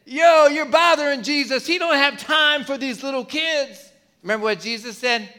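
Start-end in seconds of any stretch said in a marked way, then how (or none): 0.0-3.9 higher, louder brush-off; mock disciple scolding; annoyed disciples. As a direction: shoo the kids away, irritated. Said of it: "the disciples said".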